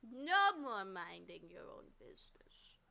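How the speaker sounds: a lightly voiced grumpy cat; low slow cat voice. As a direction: growl it slowly at the cat. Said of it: "repeat, low slow take".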